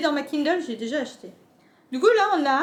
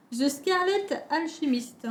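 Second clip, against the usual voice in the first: English with a strong French accent; 4 dB quieter, slower than usual. Real, not acted. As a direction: read the English slowly in a thick French accent. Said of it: "Avec mon accent, euh, là, de, l'accent français, il ressort à fond". (fort accent français en anglais)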